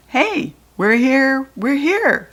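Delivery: loud, drawn-out happy call; calling out thrilled to arrive; hollered. A narrator's reading. Judy B hollering thrilled at arriving. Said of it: "I hollered".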